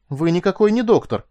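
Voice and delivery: high, loud astonished accusation; shocked gasp. A narrator's reading. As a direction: exclaim as you catch an impostor. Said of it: "ахнула она".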